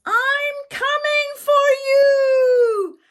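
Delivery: big sister yelling to her falling sister. Acted. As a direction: shout bravely down the hole. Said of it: "and yelled".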